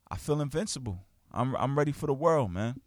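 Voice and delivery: usual speaking voice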